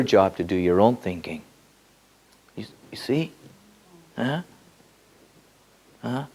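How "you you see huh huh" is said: hushed check-in; whisper. Real, not acted. Asking the audience if they follow, whispered. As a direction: whisper it to the listeners.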